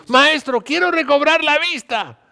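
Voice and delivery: high, loud beggar's plea; ruego a gritos. A narrator's reading. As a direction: call out your wish desperately, stretching the words. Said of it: "El ciego le dijo". (súplica aguda y a gritos)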